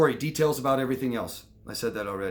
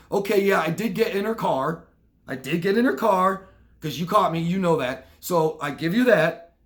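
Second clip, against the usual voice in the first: higher and louder, conceding ground; half-admitting husband; 5 semitones higher, 5 dB louder than usual. Retold or imitated. Husband answering his suspicious wife, grudgingly conceding. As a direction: admit the car bit to dodge the rest.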